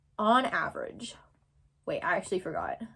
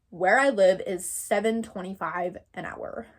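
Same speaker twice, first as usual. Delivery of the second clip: ordinary talk, nothing marked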